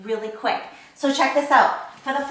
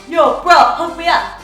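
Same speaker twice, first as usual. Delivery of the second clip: gym bro; 3 semitones higher, 6 dB louder than usual. Retold or imitated. Acting out guy who can't reach.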